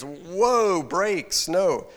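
loud, high "whoa, stop" objection; loud protest. Acted. Peter refusing Jesus's prediction, a loud high protest. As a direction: slam on the brakes out loud.

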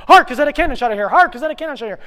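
high, loud, rapid rehearsal; frantic shouted line. Acted. Late actor rehearsing on the dash, frantic shout. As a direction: shout it on the run.

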